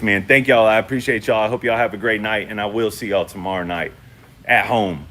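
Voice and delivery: ordinary delivery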